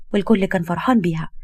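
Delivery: usual speaking voice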